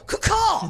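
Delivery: high squawking crow caw; crow caw scream. Retold or imitated. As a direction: screech it like a crow. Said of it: "She going".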